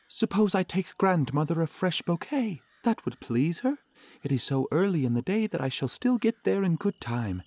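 put-on high, cheerful little girl; light child thinking aloud. A narrator's reading.

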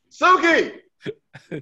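high, loud mock call; exaggerated high-pitched demo; demonstrated saying. Shown as a demonstration. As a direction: show them how it's said, loud and high. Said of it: "This is how I".

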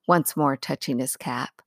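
ordinary delivery

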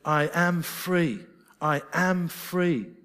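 slow, firm declaration; a steady, certain announcement of freedom; declaring. Shown as a demonstration. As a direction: proclaim it as settled fact, slowly. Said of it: "It was declaring".

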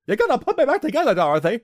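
high, loud, hurried mock cry; Scarecrow impression. Damaged character begging Dorothy, high loud mock voice.

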